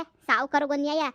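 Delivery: ordinary delivery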